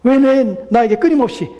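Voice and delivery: high, insistent protest; complaining. Acted. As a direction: pile up aggrieved whys, easing at the end. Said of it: "라고 불평할 수 있겠습니까?".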